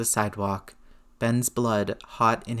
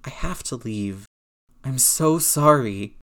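tearful; 3 dB louder, slower than usual. A narrator's reading. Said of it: "Peter rasped".

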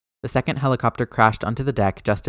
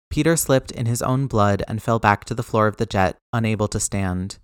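ordinary delivery, nothing marked

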